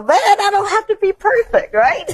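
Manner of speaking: ordinary talk, nothing marked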